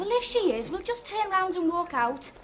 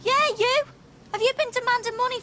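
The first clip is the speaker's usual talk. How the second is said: calling out; 6 semitones higher, 4 dB louder than usual. Real, not acted. Calling out to parking boy.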